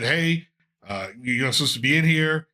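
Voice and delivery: ordinary talk, nothing marked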